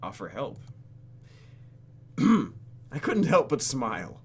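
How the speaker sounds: ordinary talk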